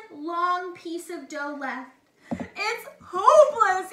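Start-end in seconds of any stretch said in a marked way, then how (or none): 2.3-3.9 loud, higher hammed-up sob; mock crying. A narrator's reading. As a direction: fake a wailing cry. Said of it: "he cried".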